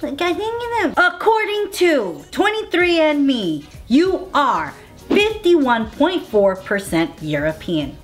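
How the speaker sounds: high-pitched, showy results announcement; mock announcer. Host revealing friend's ancestry results, mock announcer. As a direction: proclaim the result like a show host.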